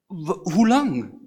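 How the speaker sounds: anguished cry, stretched long. Acted. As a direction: call it out slowly to God. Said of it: "En dat dan uitroepen".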